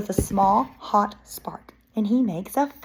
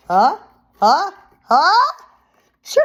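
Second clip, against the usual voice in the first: building sneeze, high then burst; big sneeze; 8 semitones higher, 9 dB louder than usual. Acted. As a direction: wind up and sneeze. Bear sneezes.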